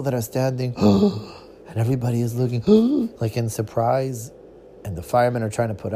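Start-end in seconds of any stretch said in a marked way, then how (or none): 2.7-3.2 sharp gasp. Acted. Crowd gasps.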